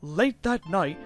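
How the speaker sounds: plain reading voice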